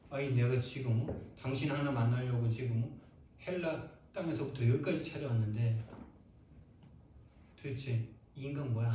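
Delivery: normal talking voice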